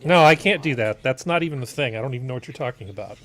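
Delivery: usual speaking voice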